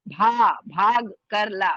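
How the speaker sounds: भाग with a breathy, aspirated भ, said slowly. Shown as a demonstration. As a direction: stretch भाग कर ला with a puff on भ. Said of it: "बाग नहीं बोलेंगे". (धीरे, भारी भ के साथ भाग)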